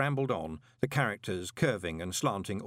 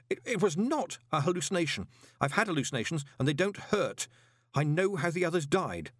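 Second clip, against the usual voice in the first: high, rushed fear; stammering to be believed; panicked; 7 semitones higher than usual. A narrator's reading. Terrified man babbling to golem.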